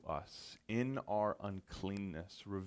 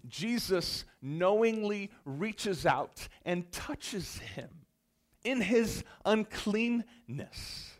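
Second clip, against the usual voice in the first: high, slow, drawn-out emphasis; slow impassioned preaching; 11 semitones higher, 7 dB louder, much slower than usual. Acted. Pastor stressing Jesus touching the leper, slow intense preaching. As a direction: linger on every word of the touch. Climax of the healing moment.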